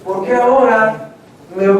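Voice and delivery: ordinary talk, nothing marked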